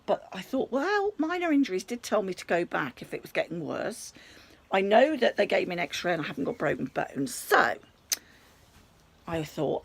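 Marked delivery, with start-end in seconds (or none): none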